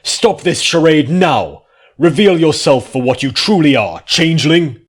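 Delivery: unmask him with every word; furious command. A narrator's reading. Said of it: "Celestia snapped".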